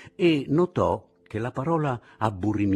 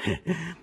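short mocking laugh; 10 semitones higher than usual. Sentry laughs at them.

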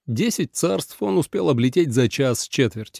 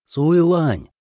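raised, higher call of a name; 4 semitones higher, 3 dB louder than usual. A narrator's reading. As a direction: hail her by name. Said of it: "окликнул Ли Цзэ".

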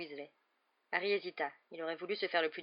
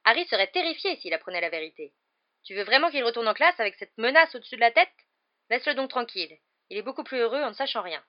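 loud, raised, urgent objection; worried, arguing mother; 5 semitones higher, 11 dB louder than usual. A narrator's reading. Wife insisting Harry not be told, heated. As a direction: plead forcefully to protect the boy.

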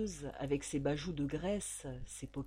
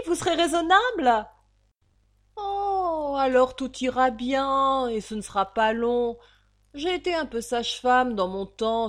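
much higher and louder, falsely sweet; sly wheedling landlady; 4 semitones higher, 10 dB louder than usual. Acted. The landlady wheedling the girl toward prostitution. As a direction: coax her with oily sweetness.

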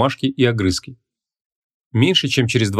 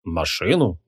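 low, soft, thoughtful echo; pondering; 5 semitones lower, 4 dB quieter, slower than usual. A narrator's reading. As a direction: repeat it musingly, pitched down. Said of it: "задумался маг". (задумчиво, низко и негромко)

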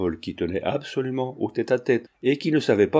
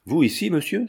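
a sudden, higher cry of shock; surprised cry; 6 semitones higher than usual. A narrator's reading. The marquise, startled to see Paul in her garden, cries out. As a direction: exclaim in alarm at the unwelcome visitor. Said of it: "s'écria-t-elle".

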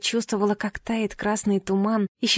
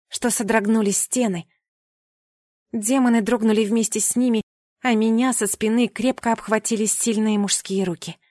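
ordinary delivery

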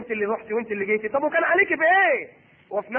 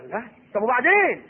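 ordinary delivery

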